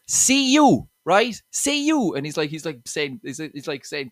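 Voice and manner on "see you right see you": put-on much higher, louder thick Limerick accent calling out; Limerick local. Angry Limerick local calls a confused teen over, loud thick Limerick accent. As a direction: beckon a stranger over in a broad, hammed-up Limerick accent. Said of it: "He goes".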